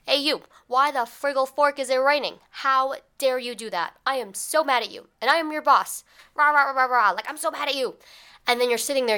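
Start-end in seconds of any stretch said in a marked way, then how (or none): 0.1-6.0 higher, louder mock yelling boss; mock angry boss. Boss scolding employee, hammed-up mock yell. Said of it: "someone yelling at you and being like". 6.3-7.1 loud, higher mock ranting noises; mock angry rah rah. Boss ranting at employee, hammed-up rah noises. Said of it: "someone yelling at you and being like". 7.1-8.0 mock angry boss. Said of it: "someone yelling at you and being like".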